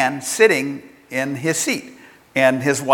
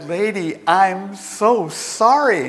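slow, higher-pitched imitation of abject apology; retold mortified apology; 6 semitones higher, slower than usual. McGuigan realizes he had the wrong seat, mortified apology. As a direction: drag out the embarrassed apology.

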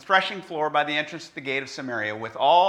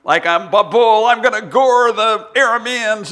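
loud hammed-up bull boast, pitched up; charging bull; 7 semitones higher, 10 dB louder than usual. False prophet showing off to king, mock bull roar. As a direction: bellow it like a prophet playing bull. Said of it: "he's running around going".

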